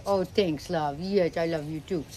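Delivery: ordinary talk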